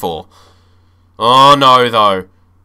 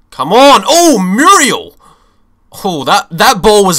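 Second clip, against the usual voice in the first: higher, louder cry of despair, dragging out the oh; louder, pained groan at the screen; frustrated shout; 6 semitones higher, 5 dB louder than usual. Real, not acted. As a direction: yell at the player in disbelief. Reacting to a missed chance.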